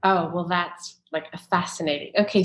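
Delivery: ordinary talk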